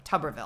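"Tuberville" said TUB-er-vil, low and soft; "Tuberville" starting like "tubber"; corrected pronunciation. Shown as a demonstration. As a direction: try out "Tuberville" with the vowel of "tub". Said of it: "but hey, now I do".

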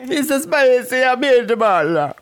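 hammed-up Italian again, high and drawn out; mock Italian accent. A podcaster showing off his Italian accent, mock Italian. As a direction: lean into the cartoon Italian accent. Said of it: "so my accent is spot on".

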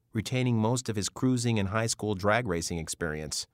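plain reading voice

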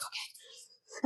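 unvoiced breathed okay; whispered okay. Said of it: "And she's like".

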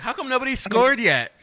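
high and loud, baffled imitation; hammed-up confused fan; mock clueless girlfriend. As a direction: squeal it like she's lost at the match. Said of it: "She's like".

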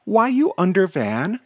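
put-on high, loud toddler voice; very young child. A narrator's reading. Toddler questions heroes under a van, small child's voice. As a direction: pipe up like a tiny child. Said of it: "says the remarkably young voice".

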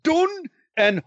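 high, slow mock Scottish brogue, hammed up; hammed-up Scottish accent; put-on Scottish voice. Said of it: "in the Scottish language".

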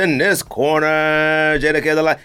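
stretched-out ring announcer call; Michael Buffer. Retold or imitated. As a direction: draw out the introduction like a boxing announcer. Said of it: "Michael Buffer was out there, you know, to do the".

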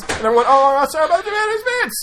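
high, loud announcer call of number one; big reveal. Acted. Host announces the top cover like a countdown climax, loud and high. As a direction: hype the final pick like a countdown host.

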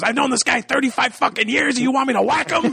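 mock Pesci outrage. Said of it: "he goes".

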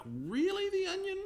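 high, rising disbelief; mock incredulity. Reader reacting to a satire site's joke, incredulous. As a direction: question it in a very high, incredulous pitch.

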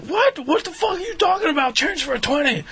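high, shouted disbelief; outraged dealer. Retold or imitated. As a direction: act baffled and furious. Dealer outraged at change request.

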